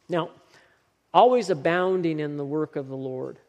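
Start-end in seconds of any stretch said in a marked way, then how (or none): 1.1-3.4 always punched, louder; stress on always. Shown as a demonstration. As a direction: lean hard on the word always. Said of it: "This is Paul's emphasis".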